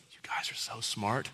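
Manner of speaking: whispered flattery, voice dropped to nothing; mock-secret whisper of praise. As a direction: breathe it like a secret compliment. Imagined Jesus praising them.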